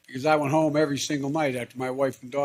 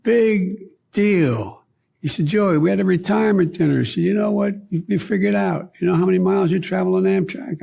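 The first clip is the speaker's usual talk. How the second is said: animated quote; 6 semitones higher, 5 dB louder than usual. Retold or imitated. Conductor voiced, animated.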